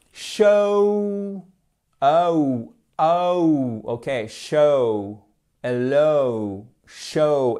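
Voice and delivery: long oh-oo glide on "Show" and "Hello"; exaggerated diphthong. Shown as a demonstration. Teacher exaggerating double vowels, drawn-out English diphthongs. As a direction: stretch every "oh" into two gliding sounds. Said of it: "j'exagère pas un peu trop".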